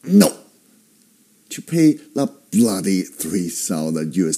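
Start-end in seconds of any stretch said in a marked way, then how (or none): 0.0-0.4 NO starts high and falls sharply; firm fourth tone. Shown as a demonstration. Teacher showing the fourth tone, firm falling NO. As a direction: put your foot down on a short, falling NO. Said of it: "put your foot down and say".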